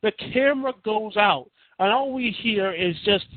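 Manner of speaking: ordinary talk, nothing marked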